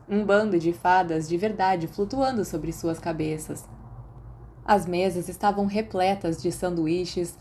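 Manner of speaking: ordinary delivery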